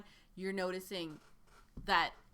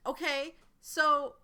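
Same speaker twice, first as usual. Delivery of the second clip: eager recruiter voice; 8 semitones higher, 8 dB louder than usual. Acted. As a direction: sell it like a true believer.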